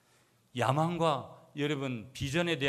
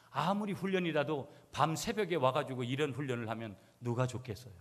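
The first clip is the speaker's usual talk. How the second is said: normal talking voice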